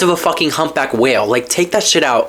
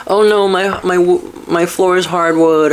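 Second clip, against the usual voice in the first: lightly whiny put-on complaint; mock whining; 4 semitones higher than usual. Imagining petty house complaints, mock whining. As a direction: fake a petty gripe, a little slow.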